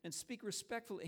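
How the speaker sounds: a father hurt and scolding his son; upset father. Retold or imitated. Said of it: "He was really upset".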